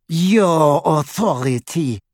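slow, scornful sneer; mocking his word back at him; drawn-out contempt. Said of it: "she sneered".

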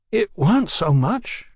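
high, slow giddy voice. A narrator's reading. Young brother playing down his punch, giddy. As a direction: say it giddily, pitched high. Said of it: "his voice giddy".

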